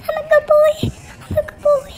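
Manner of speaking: crying puppy; whimpering pup. Acted. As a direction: beg to be called good. Dog pleading, crying.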